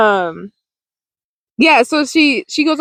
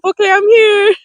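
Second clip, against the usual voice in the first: high, laughing mock-eager reply; 11 semitones higher than usual. Showing up to help friends, laughing. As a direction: giggle out an eager I'm here.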